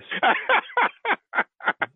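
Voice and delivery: long high falling laugh. Real, not acted. Caller laughs at victim.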